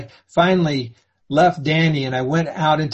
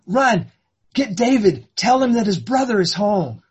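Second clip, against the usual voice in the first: excited urgent order; 6 semitones higher than usual. Acted. Father sends dishwasher for David.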